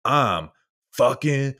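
drawn-out, slow fake anger; mock a poser forcing a curse, slowly; slow, hammed-up mock fury; fake tough guy. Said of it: "who's trying to be like".

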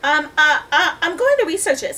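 high, loud, hesitant stammer; nervous. A narrator's reading. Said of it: "He's so nervous".

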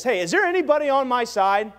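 Jehu calling up to the eunuchs at the window, loud. Acted. Shout it up to the tower.

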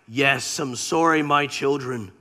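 God, low and gentle; God sadly answering his children, low voice. Retold or imitated. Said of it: "And then God saying back".

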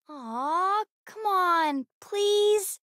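wheedle, pushing the pitch up on each bit; pleading. Acted. Child begging an adult to give in, pleading.

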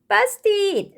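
put-on high, loud toddler exclamation; high-pitched baby character; story baby. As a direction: cry it out as a little child. Baby Blair voiced in story.

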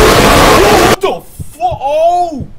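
startled slow shout. Real, not acted. Jump scare.

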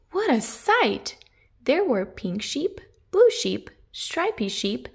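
ordinary delivery

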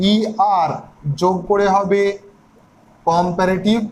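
normal talking voice

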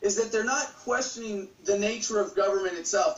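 ordinary talk

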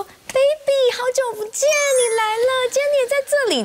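high, syrupy mock-friendly gushing; fake sweet greeting. Imitating a two-faced woman gushing hello. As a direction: gush over her like a phony friend. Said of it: "就是那种笑容已经堆到这里来的".

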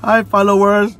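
bright, loud hello to viewers; loud cheerful greeting. Shown as a demonstration. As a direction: call out the greeting. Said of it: "Say hi to your followers".

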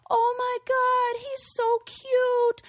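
high, drawn-out gushing squeal; thrilled kid. Retold or imitated. Kids reacting to a friend's new dog picture, thrilled and high-pitched. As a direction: gush like kids adoring a puppy picture. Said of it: "every single kid who saw the picture gave, like, the exact right response".

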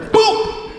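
high breathy boop; short mock kick noise; imitated kick sound. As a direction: pop it like a foot hitting the seat. The kick again.

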